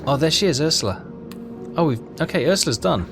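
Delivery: usual speaking voice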